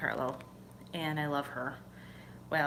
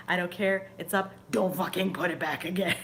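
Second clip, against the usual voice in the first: laughing through the words; 2 semitones higher, 4 dB louder than usual. Retold or imitated. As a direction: giggle while scolding the husband. Tells husband Kevin, laughing.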